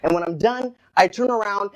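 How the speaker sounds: normal talking voice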